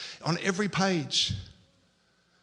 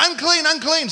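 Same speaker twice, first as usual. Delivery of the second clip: high, loud acted cry; yelled warning; 8 semitones higher, 5 dB louder than usual. Unclean man warning someone about to shake hands, yelled warning. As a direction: shout it out to warn people off. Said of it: "he has to yell out".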